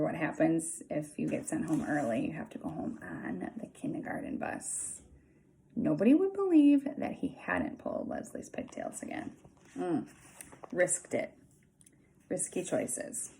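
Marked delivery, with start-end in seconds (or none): none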